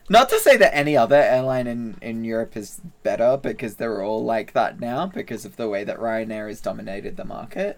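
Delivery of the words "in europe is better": usual speaking voice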